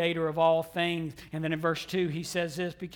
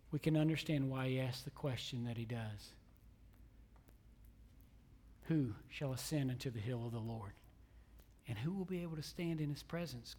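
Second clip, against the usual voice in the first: low, soft pulpit voice; subdued, searching sermon delivery; hushed preaching; 4 semitones lower, 9 dB quieter than usual. Real, not acted. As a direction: pose the question quietly to the congregation.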